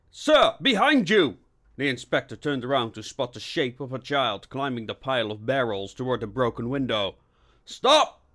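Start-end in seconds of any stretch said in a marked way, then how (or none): 0.1-1.4 high, loud alarmed shout; urgent warning. Acted. Officer spots someone behind his boss, shouting a warning. 7.6-8.2 high, loud command; cried out. A narrator's reading. Inspector spots fleeing child, crying out to halt. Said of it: "he cried".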